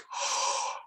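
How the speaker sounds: soft breathless gasp of amazement; mock gasp. Tony hears the crowds came to see him, mock gasp. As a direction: gasp in astonished disbelief. Tony's reaction to crowd, voiced.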